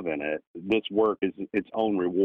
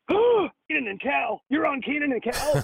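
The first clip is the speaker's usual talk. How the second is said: much higher, bright put-on voice of an excited director; Tom Hanks impression. Star director reading a resume, in a high delighted voice. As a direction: play the delighted star who recognizes the show. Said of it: "he goes".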